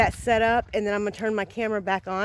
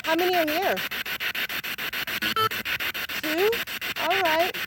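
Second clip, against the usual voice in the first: jumpy whispering ghost hunt; 5 semitones higher than usual. Real, not acted. Calling out to spirits, spirit box.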